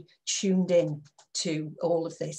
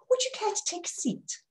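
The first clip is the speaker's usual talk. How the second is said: much higher, softer and oily; smarmy; 12 semitones higher, 5 dB quieter than usual. Shown as a demonstration. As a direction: fawn over the guest. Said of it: "Really sort of smarmy, toady".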